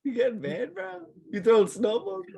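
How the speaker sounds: high squeaky mocking voice; mock high teasing voice. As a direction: tease him in a high voice. Mocking friend's snippiness.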